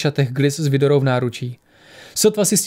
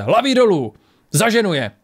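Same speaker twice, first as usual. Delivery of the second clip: higher-pitched call to take cover; urgent warning; 6 semitones higher than usual. A narrator's reading. Gunman warns companions before firing, urgent warning. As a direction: warn them urgently, pitch jumping up.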